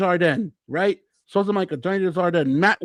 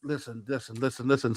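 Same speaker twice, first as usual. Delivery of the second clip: much softer, lower listen listen; soft hushing listen; 9 semitones lower, 10 dB quieter than usual. Real, not acted. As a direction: hush him down gently. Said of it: "calming Paul down".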